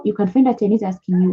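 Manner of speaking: usual speaking voice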